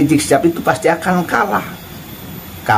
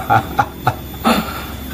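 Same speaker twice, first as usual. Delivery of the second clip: mocking snicker; 3 semitones lower, 7 dB quieter than usual. Real, not acted. Mocking a leaked recording.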